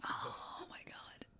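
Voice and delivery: breathless hushed whisper. Real, not acted. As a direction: whisper it in awe. Whispered reaction and quote.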